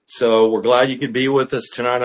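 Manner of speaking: ordinary talk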